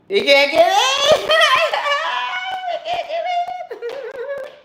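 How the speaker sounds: high shouted words through laughter; laughing gloat. Real, not acted. Gloating that others can't get in, shouting through laughs. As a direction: crack up while shouting it.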